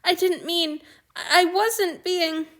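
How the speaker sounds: choking on tears mid-sentence; crying. A narrator's reading. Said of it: "he was crying".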